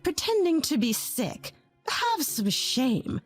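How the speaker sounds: sneering. Acted. Mother-in-law sneering at her.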